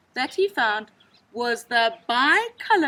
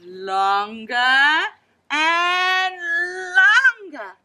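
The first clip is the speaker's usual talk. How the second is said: slow, rising louder and higher; building; 6 semitones higher, 8 dB louder, much slower than usual. A narrator's reading. Narrator stretching the words as the nose grows, building. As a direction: build each longer to a peak.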